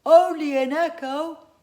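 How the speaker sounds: loud echoing shout back; echo yelling. Acted. Echo shouting the words back at the children. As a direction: boom it back like a cave echo. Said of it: "yelled the echo".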